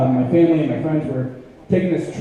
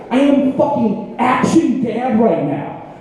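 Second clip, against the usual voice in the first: ordinary delivery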